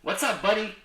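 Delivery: ordinary delivery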